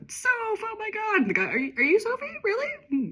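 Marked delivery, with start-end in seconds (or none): 0.1-1.2 high, slow squeal of a name; mock scream. [name] greeting Sophie with a high, mock squeal. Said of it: "[name] has just screamed at her". 1.4-3.1 amazed. Acted. Awed assistant meeting Sophie.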